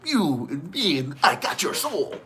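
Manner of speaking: a hint of a creepy wrestler, drawn out; Jeff Hale impression. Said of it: "I don't even know how to".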